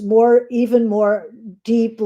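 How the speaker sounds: normal talking voice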